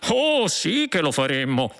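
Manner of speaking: insistent. A narrator's reading. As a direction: insist on it as the policeman. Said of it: "insisteva il poliziotto".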